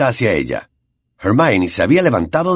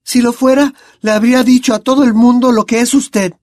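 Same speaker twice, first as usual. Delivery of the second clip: put-on much higher and louder girl's voice; Hermione retorting; 6 dB louder than usual. A narrator's reading. Hermione answers Lupin, much higher and louder. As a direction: fire it back at him.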